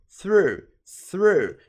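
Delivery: "through" repeated with falling OO; contrasting OO vowel. Shown as a demonstration. Teacher setting through against throw, falling OO. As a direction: drill "through" with TH and long OO. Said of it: "a gente fala through".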